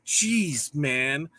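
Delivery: ordinary talk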